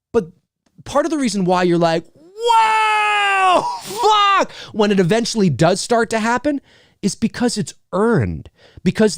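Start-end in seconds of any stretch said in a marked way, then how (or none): 2.1-4.5 high, drawn-out amazed cry; long, soaring astonished exclamation; stunned awe. Acted. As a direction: react like a blown-away viewer. Acted stunned viewer reaction.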